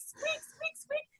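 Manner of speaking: high, soft squeaky bird-chirp noise; mock squeak. Mother imitating the squeaking ear for the guests.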